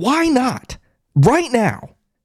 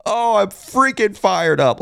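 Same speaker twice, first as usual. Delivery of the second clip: ordinary delivery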